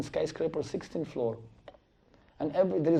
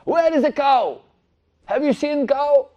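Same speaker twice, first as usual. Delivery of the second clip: loud, much higher demanding yell; shouting butcher; 11 semitones higher, 8 dB louder than usual. Acted. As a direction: yell it at the sitting Brahmana. Butcher demanding the cow again.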